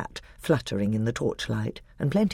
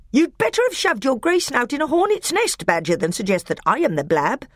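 much higher, louder furious dwarf; higher indignant dwarf; outraged dwarf; 12 semitones higher, 4 dB louder than usual. A narrator's reading. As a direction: bristle at the accusation. Nick a Brick indignant.

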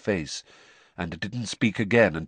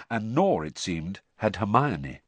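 ordinary delivery

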